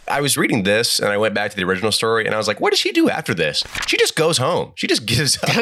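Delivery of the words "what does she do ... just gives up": high-pitched, exasperated incredulity; retold disbelief. Reader baffled by the story's twist, incredulous. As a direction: protest a baffling plot turn.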